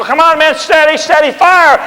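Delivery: loud, high call to fire; rally the men under fire; shouted orders. Retold or imitated. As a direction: battlefield command shouted. Washington commanding on foot.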